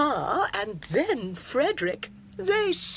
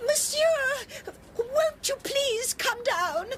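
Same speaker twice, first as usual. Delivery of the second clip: much higher, crying shout across the street; desperate call; slower than usual. Acted. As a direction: cry out for help to the neighbour at the window. Calling neighbour from across street.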